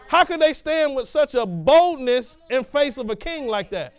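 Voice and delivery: usual speaking voice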